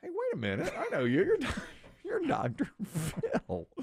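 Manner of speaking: high-pitched dawning realization with a chuckle; mock surprised recognition. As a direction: imitate an arrested man suddenly recognizing someone. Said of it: "the guy eventually ... goes".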